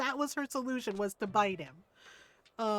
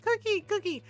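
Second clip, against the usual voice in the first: put-on high-pitched little-boy begging; excited toddler; 10 semitones higher, 4 dB louder than usual. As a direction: beg like a bouncing toddler. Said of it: "Our grandson, he's like".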